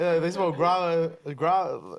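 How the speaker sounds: loud, drawn-out mimicry of her phrasing; mocking imitation. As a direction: mimic her slowly and loudly, teasing. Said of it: "why you say it like that?".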